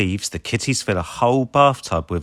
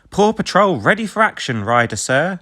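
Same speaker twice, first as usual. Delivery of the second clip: Chase voice; 9 semitones higher, 4 dB louder than usual. A narrator's reading. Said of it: "says Chase".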